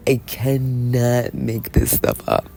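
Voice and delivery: slow, drawn-out repeat; slow emphatic. Real, not acted. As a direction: drag it out for emphasis.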